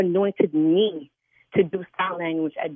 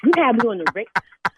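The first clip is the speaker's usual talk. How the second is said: ordinary talk, nothing marked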